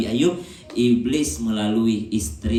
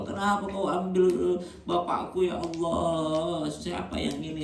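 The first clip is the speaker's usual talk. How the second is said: grieving mourner; 6 semitones higher, slower than usual. Retold or imitated. Mourner blaming God, voiced.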